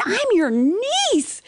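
high, loud, very slow, each word drawn out; exasperated plea. Retold or imitated. Niece pleading with her aunt, drawn out and loud. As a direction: stretch it out in disbelief. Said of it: "I said".

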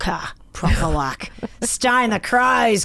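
hammed-up ocker Australian accent; imitating a very Australian woman; broad Aussie. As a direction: lay on a thick, proper Aussie twang. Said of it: "really Aussie as well".

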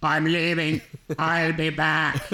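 low monotone throat-cancer rasp; robotic voice-box drone. Acted. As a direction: imitate a man talking after throat cancer. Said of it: "throat cancer".

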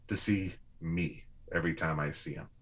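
ordinary talk

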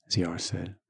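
usual speaking voice

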